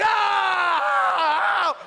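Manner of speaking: loud, very high imitated baby scream; long piercing mock wail; newborn's scream. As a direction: scream like a newborn in full cry. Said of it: "she's, like, screaming".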